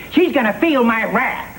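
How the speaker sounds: a lightly voiced, hammed-up menacing villain, high on wrath; Basil Rathbone impression. A director hamming a threat for the producer in a weak Basil Rathbone voice. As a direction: poorly mimic a theatrical screen villain, punning on his name.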